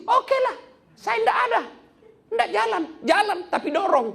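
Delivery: hammed-up high, loud pouting voice with a laugh in it; sulky fuel voice. The offended petrol telling the car to be pushed, high mock-pouting voice. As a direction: play the petrol as a huffy, offended character.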